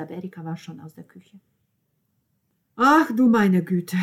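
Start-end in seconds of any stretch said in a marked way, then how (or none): 2.8-4.0 slow, very loud exclamation; appalled grandmother. A narrator's reading. Grandmother hears of the scandal, loudly appalled. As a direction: drag out the dismay loudly. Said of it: "wiederholte Virna".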